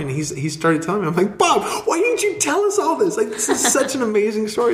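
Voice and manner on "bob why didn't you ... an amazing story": much higher, thrilled questioning; excited exclaim. Retold or imitated. Telling Bob his story is amazing, much higher and thrilled. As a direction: burst with delight at the news.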